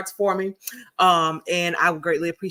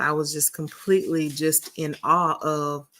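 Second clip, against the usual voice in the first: ordinary delivery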